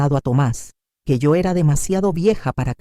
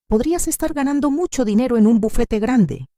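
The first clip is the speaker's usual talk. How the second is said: much higher character voice for a woman in a story; voiced Camila; 9 semitones higher than usual. A narrator's reading. Camila questioning the lawyer's choice, in a high character voice. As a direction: lift the pitch right up to play her.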